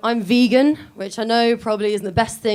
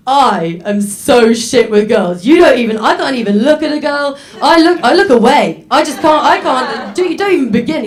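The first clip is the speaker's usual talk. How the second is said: out-complain your friend, louder; competitive rant; 4 semitones higher, 7 dB louder than usual. Acted.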